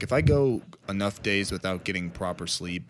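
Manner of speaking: usual speaking voice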